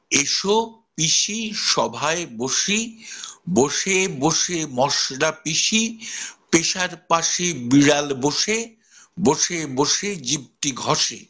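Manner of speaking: very slow, every স sound spelled out; slow tongue twister. Shown as a demonstration. Teacher demonstrating the three স sounds in a rhyme, slow. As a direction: recite the twister slowly word by word. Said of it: "দন্তস্ব আছে, তালব্য স আছে".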